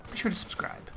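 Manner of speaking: whispered plug, almost secret; hushed aside to viewers. Real, not acted. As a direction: slip it in under your breath. Sneaking in a subscribe plug.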